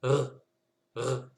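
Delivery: R, r: consonne seule, coupée net; stopped r. Shown as a demonstration. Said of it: "On vient stopper". (r sec, sans voyelle)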